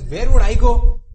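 loud, high desperate pleading. Retold or imitated. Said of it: "she says".

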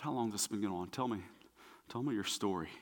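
very soft, low, tender voice; gentle invitation. Acted. Jesus asking the father his story, hushed and low. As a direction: quietly coax the story out of him.

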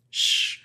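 imitated hushing "shh"; shushing. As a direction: shush as the character does. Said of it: "She literally shushes".

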